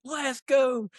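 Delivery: put-on high-pitched celebratory cheer, as the excited boy; hyped. Nine-year-old celebrating his first doe, hyped. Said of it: "Dude, he is hyped".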